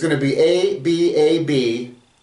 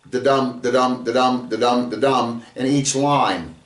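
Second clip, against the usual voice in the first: "da-dum" repeated with a light "da" and a stressed "dum"; da-dum rhythm; 2 semitones lower than usual. Shown as a demonstration. Teacher demonstrates the meter as a rhythmic, heartbeat-like da-dum. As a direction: beat it out like a heartbeat. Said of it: "five of these".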